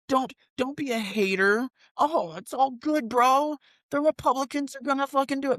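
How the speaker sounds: high, slowed mock-dopey supporter voice. Host mocking loyal party voters, high dopey mock voice.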